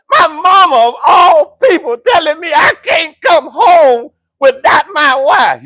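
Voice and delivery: high, loud, laughing half-cry of disbelief; laughing outcry. Real, not acted. Pastor laughing at his mother's order, high loud cry. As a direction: cry out through laughter at mama's ruling.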